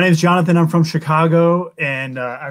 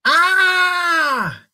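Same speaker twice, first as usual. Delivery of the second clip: creature shriek; 14 semitones higher than usual. Acted. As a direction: shriek in fright. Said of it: "its scream curled all their toes".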